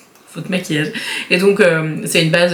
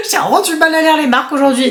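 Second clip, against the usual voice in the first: higher-pitched, laughing through the words; 8 semitones higher, faster than usual. Real, not acted. Beauty vlogger tripping over brand names, laughing. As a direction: talk through a fit of real giggles.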